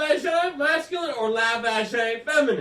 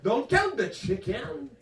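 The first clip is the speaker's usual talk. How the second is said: slow, higher French Canadian mother; French Canadian accent; 5 semitones higher, slower than usual. Retold or imitated. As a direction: stretch out the mangled saying in French Canadian English. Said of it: "my mother's like".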